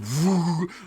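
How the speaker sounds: loud "vwoo" gadget noise; vocal whoosh effect; imitated whoosh. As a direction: mouth the sound of a magic item switching on. Shopkeeper imitates gadget's sound.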